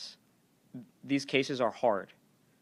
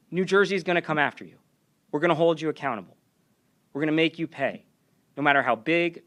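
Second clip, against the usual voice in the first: higher, louder podium warning in punchy phrases; forceful formal speech; 6 semitones higher, 6 dB louder than usual. Acted. Warning companies that harm our kids, forceful formal speech. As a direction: deliver a hard warning, pausing between blows.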